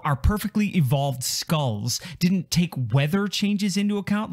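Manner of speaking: usual speaking voice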